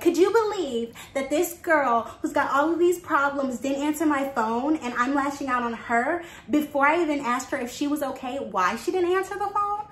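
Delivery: hammed-up gossip at high pitch; mock gossip. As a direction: ham up a self-centered complaint. Hypothetical mock of self-centered gossip.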